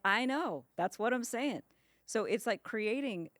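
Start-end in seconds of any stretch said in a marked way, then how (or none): 0.0-1.6 emphatic agreement. Retold or imitated. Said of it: "And you're like".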